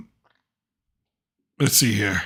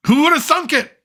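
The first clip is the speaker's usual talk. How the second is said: mock wonder; 12 semitones higher than usual. Joking about diaper absorption.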